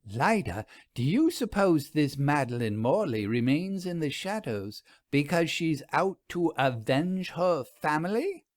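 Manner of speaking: steady storyteller reading, voicing Lida and Vera; narrating a period ghost mystery with lightly voiced characters; story narration. A narrator's reading. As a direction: read it like a cozy mystery tale at the fireside. Author reads his ghost story aloud.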